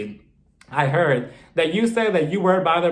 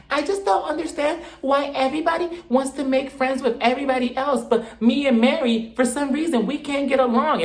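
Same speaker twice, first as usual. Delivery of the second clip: higher, put-on whiny Whitney voice; Whitney complaining nobody wants her as a friend, in a higher mock whine; 8 semitones higher than usual. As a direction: whine it like a slighted castmate. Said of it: "she's like".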